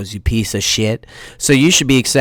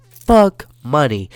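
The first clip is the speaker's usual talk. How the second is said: ordinary talk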